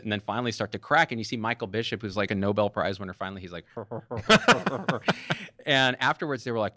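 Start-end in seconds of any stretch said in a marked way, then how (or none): 3.7-5.6 imitated laugh. Nobel winner finally cracks.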